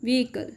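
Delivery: "vehicle" as VEE-i-kul; careful pronunciation. Shown as a demonstration. Teacher giving learners the word, careful pronunciation. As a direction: say "vehicle" with the H silent.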